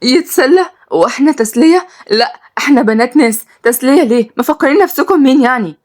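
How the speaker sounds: high, outraged disbelief; shocked indignation. A narrator's reading. Girl outraged at being called entertainment. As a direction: throw the word back in shock. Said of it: "واتصدمت ملك".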